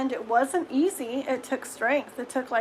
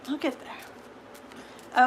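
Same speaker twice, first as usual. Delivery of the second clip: whispered; 5 dB quieter, much slower than usual. Real, not acted. Quiet promise to herself.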